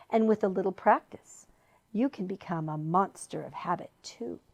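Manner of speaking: soft, hushed luring voice; whispering deceitful lure. A narrator's reading. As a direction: coax them in gently. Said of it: "continued to whisper in a soft, deceitful voice".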